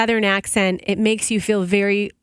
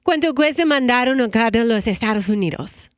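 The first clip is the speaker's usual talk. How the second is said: Spanish phrase; 3 semitones higher than usual. Shown as a demonstration. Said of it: "Here are some of my Spanish expressions".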